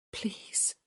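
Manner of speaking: breathless whispered plea. A narrator's reading. Hyacinth begging before she looks, a whisper. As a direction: beg under the breath. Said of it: "she whispered".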